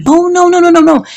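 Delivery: loud, high run of no's; emphatic refusal. Retold or imitated. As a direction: shut them down with rapid-fire refusals. Acted defiant refusal in retold quarrel.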